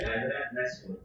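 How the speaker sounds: hadza najmun said soft and flat, no bounce on the j; a quiet wrong najmun without qalqalah; mock wrong najmun, no echo; wrong reading. Shown as a demonstration. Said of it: "Jangan dibaca".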